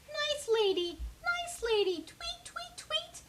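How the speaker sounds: high chirpy little birds, very high; high sweet bird chirps; sweet birdsong voices. A narrator's reading.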